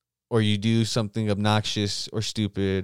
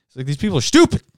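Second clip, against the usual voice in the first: ordinary delivery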